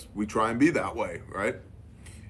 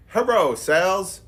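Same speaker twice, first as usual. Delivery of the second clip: mock broken-English scam email; 9 semitones higher, 7 dB louder than usual. Rep mocking the scammer's email to a colleague, high and loud.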